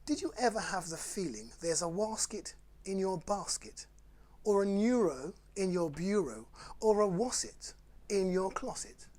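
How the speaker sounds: playful bedtime story reading; reading rhymes to children. Acted. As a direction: read the silly rhymes to the kids with relish. Reads Dr Seuss aloud.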